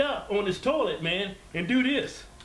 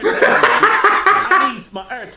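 drawn-out, louder demand through laughter; joking plea for more airtime; mock demand; 5 semitones higher, 11 dB louder, much slower than usual. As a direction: beg for airtime, hamming it up.

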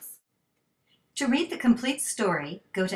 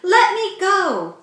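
much higher, louder, slow struggling shout; frantic shout; 11 semitones higher, 9 dB louder, slower than usual. A narrator's reading. Kevin fighting off Lydia, frantic shout.

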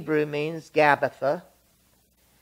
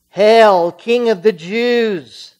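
mocking hail; 6 semitones higher, 10 dB louder, slower than usual. Soldiers mocking Jesus.

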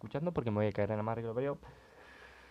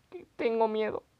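higher, a hint of hammed-up fright; mock fear; 10 semitones higher, 3 dB louder than usual. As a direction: fake a scared youtuber cry. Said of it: "diría Auronplay". (miedo fingido, agudo)

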